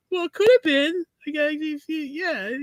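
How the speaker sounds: high lisping Tyson-style dad impression; Mike Tyson sideways. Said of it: "he was like".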